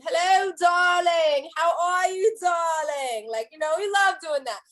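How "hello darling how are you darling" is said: loud, higher, hammed-up London accent. Shown as a demonstration. Showing a Londoner how Americans imitate them, hammed-up London accent. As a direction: put on a playful, drawn-out London accent. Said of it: "putting on our London accents".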